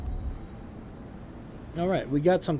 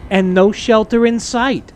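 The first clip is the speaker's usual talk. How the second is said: loud, raised, pointed mom voice; passive-aggressive mom; 4 semitones higher, 10 dB louder than usual. Acted. Streamer swapping the evil voice for a mom's, passive-aggressive. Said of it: "passive-aggressive mom voice".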